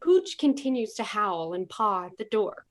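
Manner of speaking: ordinary talk, nothing marked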